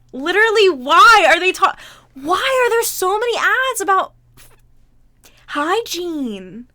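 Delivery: indignant yell; exasperated shout. Real, not acted. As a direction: complain loudly at the screen. Exasperated at hygiene ads.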